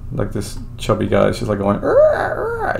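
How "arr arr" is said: high screechy mock pterodactyl cry; imitating a pterodactyl screech; pterodactyl shriek. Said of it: "making, like, pterodactyl noises".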